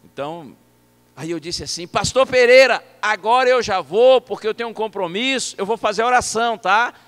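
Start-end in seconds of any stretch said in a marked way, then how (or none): 1.9-6.9 loud, high, projected voice; alto volume. Retold or imitated. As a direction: shout so a nearly deaf man hears. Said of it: "de alto volume". (falando bem alto para surdo)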